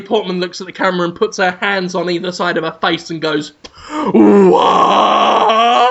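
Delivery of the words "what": loud stunned cry; shocked shout. Pretend. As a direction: gasp it out in horror. Natalie Portman shocked to camera.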